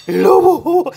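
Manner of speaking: high, loud mock alarm call; playful wolf cry. Owner showing puppy's face to camera, playful high wolf cry.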